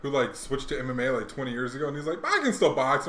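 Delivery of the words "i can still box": higher, louder imitation of an insistent boxer; mock boastful protest. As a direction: protest it loudly, mocking the boxer. Mimics an ageing boxer's boast.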